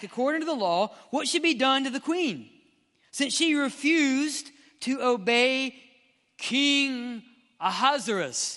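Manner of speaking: emphatic, high-pitched Bible reading; dramatic scripture reading. Acted. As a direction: declaim the royal question, slowing at the end. Reads the king's question aloud.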